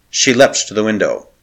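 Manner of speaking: plain reading voice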